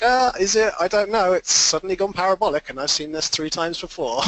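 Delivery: high, loud mock disbelief easing off; sceptical inner voice. Trader recalling New Year hype, in a high, loud mock-sceptical voice. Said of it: "and I was just like".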